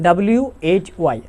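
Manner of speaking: ordinary talk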